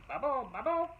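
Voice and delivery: bubbling stew voice. A narrator's reading. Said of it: "she heard the stew say".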